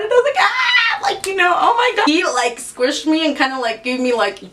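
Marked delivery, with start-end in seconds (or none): 0.4-1.0 sharp high yelp of pain. Retold or imitated. Daughter yelping as her dad squeezes her shoulder, a high sharp cry. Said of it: "he squished it so hard".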